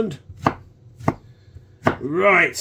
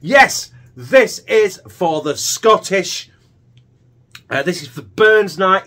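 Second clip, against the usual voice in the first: excited; 5 semitones higher, 5 dB louder, slower than usual. Acted. Proud Burns Night toast.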